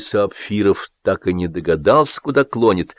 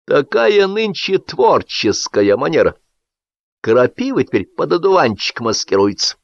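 condescending; 7 semitones higher than usual. A narrator's reading. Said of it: "снисходительно сказал Сапфирову Агап Палыч".

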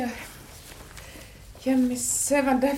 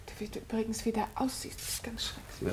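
hushed aside; 3 semitones lower, 11 dB quieter than usual. Acted. Wife scolds husband's appearance aside.

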